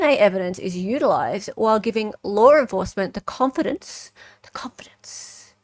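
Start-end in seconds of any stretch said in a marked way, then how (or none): 4.4-5.5 breathy whisper, drawn out slowly. Shown as a demonstration. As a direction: whisper the phrase like a secret. Repeating a phrase for effect.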